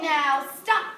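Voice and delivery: loud, firm "Now stop"; commanding stop. Acted. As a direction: order them to stop, Max style. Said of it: "said Max".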